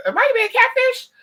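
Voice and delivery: very high, loud mock-panicked squeal; alarmed outburst. Retelling suspicion of the caller, hammed-up alarmed shriek.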